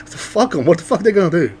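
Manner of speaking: ordinary talk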